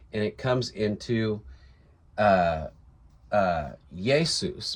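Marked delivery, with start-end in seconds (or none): 3.9-4.7 "Jesus" slowly as YAY-soos; "Jesus" as Yay-soos, no guttural h; Latin pronunciation. Shown as a demonstration. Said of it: "because they didn't have the guttural he".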